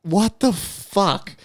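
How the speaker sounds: high, very drawn-out disbelief; wigged out. Retold or imitated. Man retelling watching strangers walk by, wigged out. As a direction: re-enact stunned bewilderment, slow and high. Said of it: "fucking wigged myself out".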